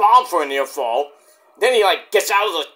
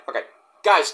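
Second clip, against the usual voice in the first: ordinary talk, nothing marked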